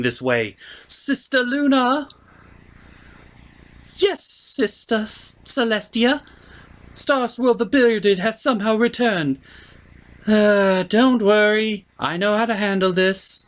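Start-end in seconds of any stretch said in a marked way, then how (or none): 0.9-2.1 higher, slow regal call; princess calling out. Acted. Princess calling her sister, higher and slow. 4.0-9.4 higher, altered royal voice; a worried young princess reporting news. Acted. Luna answering her sister in a higher princess voice. 10.3-13.4 higher, louder, slow at first; a hint of a confident royal; reassuring princess. Princess reassuring her sister, higher and slow.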